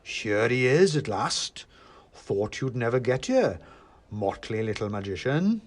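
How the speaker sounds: slow, ominous greeting; big man welcoming an intruder, not quite jolly; Father Christmas. A narrator's reading. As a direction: draw it out with mock welcome.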